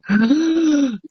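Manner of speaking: sharp gasp for air mid-sentence; demo gasp for breath. Shown as a demonstration. Narrator shows losing breath mid-sentence, sudden gasp. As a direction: show a breath running out. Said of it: "all of a sudden I go".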